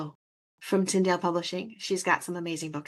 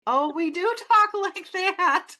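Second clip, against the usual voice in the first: high, slow, laughing admission; amused realization; 12 semitones higher, 4 dB louder, slower than usual. Retold or imitated. Host retelling her discovery of her own accent, laughing realization. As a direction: concede it high and drawn out, breaking into laughter. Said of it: "And I'm like".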